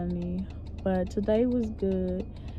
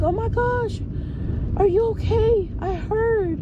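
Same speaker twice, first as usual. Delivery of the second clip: shocked concern; 10 semitones higher than usual. Retold or imitated. Coworkers checking on sick teacher, shocked concern. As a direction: imitate gushing alarm, pitched way up.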